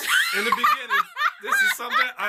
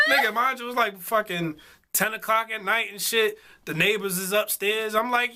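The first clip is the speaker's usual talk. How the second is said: usual speaking voice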